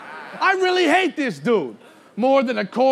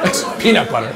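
low, flat, laughing under it; low deadpan; 10 semitones lower, faster than usual. Real, not acted. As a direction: drop it dead low like it's obvious. Repeats with disbelief.

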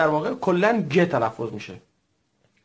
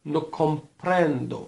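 teacher repeats No comprendo for students; slow repeat; much slower than usual. Shown as a demonstration. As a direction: model No comprendo slowly. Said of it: "تکرار بفرمایید".

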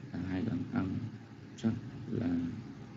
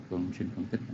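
ordinary talk, nothing marked

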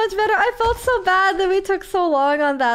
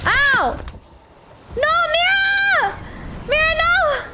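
high alarmed shout; real alarmed cry; 9 semitones higher, slower than usual. Real, not acted. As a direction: yelp at the pet to stop. Pet interferes with game.

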